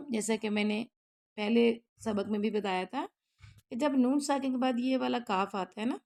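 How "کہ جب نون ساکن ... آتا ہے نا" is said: usual speaking voice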